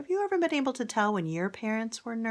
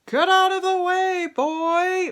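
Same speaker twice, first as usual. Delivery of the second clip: much higher and louder, commanding; king's order; 12 semitones higher, 9 dB louder than usual. Acted. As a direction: order the boy away. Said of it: "ordered the king".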